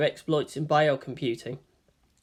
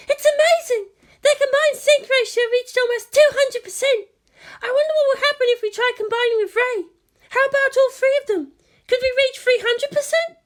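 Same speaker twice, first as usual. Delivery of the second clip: much higher and louder, thrilled junior voice; excited Maya; 5 dB louder than usual. A narrator's reading. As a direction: gush over the numbers like a delighted fan. Maya excited over data.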